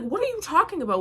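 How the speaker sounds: high-pitched baffled protest; acted disbelief. Retelling her baffled reply to accusation.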